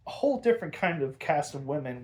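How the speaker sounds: ordinary delivery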